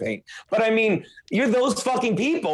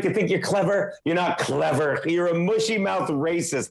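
usual speaking voice

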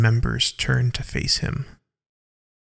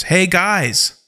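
raised, higher call for attention; louder call; 6 semitones higher than usual. A narrator's reading. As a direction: call over a bickering group. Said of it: "In a louder voice".

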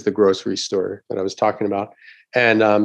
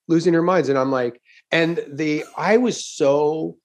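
ordinary talk, nothing marked

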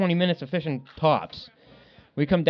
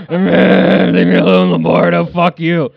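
grumpy brush-off; 3 semitones higher, 10 dB louder, slower than usual. Retold or imitated. Grumpy morning, retold to friend.

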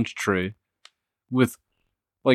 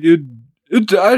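ordinary talk

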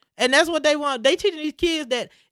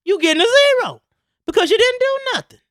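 loud, rising stern insistence; stern teacher; 6 semitones higher, 5 dB louder than usual. Acted. Teacher refusing a crying student a pass, stern insistence. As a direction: lay down the law, climbing higher. Acted teacher laying down the law.

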